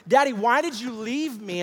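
high, loud imitation of an upset boy; distressed child's plea. Son confronting his father, in loud desperate pleading. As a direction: cry out the question, pleading as a child. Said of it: "he begins to express these emotions".

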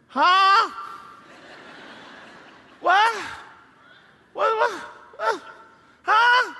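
high, loud baffled huh; dad glued to TV, annoyed; distracted dad. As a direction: play a father half-listening to the game. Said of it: "He says".

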